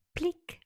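high bright pop; plic pop sound. Acted. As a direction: pop it out like a bud bursting. Flower pops open.

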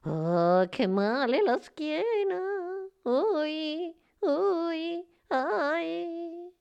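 high elf groaning over a sore back; moaning in pain. A narrator's reading. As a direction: lament in a high voice, stretching each ow. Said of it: "udì dei lamenti".

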